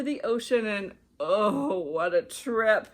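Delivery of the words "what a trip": usual speaking voice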